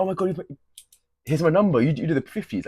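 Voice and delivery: a hint of a girl handing over her number; mock starstruck girl. Vlogger mocking a girl who would chase heavy lifters, in a lightly voiced gush.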